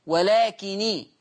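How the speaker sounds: "ولكني" slow, the nun light with a kasra; Warsh reading with kasra. Shown as a demonstration. Quran teacher showing Warsh's light kasra nun. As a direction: stretch ولكني slowly, light nun ending in i. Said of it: "يعني يقول إيه".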